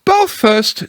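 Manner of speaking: high, cheerful Kanga voice; bright and brisk, like a busy mother. Acted. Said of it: "in a cheerful voice".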